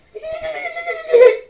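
high shrieking mock laugh; loud shrieking laugh imitation. Imitating the laughing kid video.

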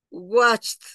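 "watched" as one syllable closing in CH plus T; final T sound. Shown as a demonstration. Teacher demonstrating the tongue position for students, final T. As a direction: swallow the E of "watched" and finish on T. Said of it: "La T la voy a meter".

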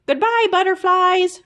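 high, bright sing-song farewell; little hamster calling to the exhibits; calling goodbye. A narrator's reading. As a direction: call out a cheerful goodbye while running. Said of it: "Fergus called".

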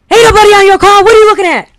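loud, high-pitched imitation of a yelling woman; yelling lady. Imitating an older lady at a fender bender, yelling. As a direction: holler it like an irate older lady. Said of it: "this lady, you know, yelling".

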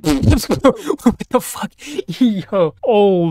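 high, slow startled laugh into a curse; real startled laughter, pitched high; laughing shock. Real, not acted. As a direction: caught off guard and cracking up. Shocked by a creepy visitor.

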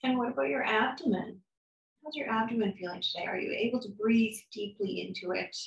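calm, soft guided meditation voice, unhurried with long pauses; guided body scan. Acted. Therapist guiding a listener through a body scan, calm and soft. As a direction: lead them gently through the body.

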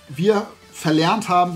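ordinary delivery

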